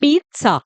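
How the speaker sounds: पिज़्ज़ा said "PEET-sa"; teacher's model पिज़्ज़ा, "peet-sah"; correct "pizza" with ts. Shown as a demonstration. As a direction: put a crisp t-s in पिज़्ज़ा, "pitsa". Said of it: "लिख भी रखा है".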